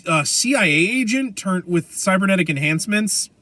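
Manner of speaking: ordinary talk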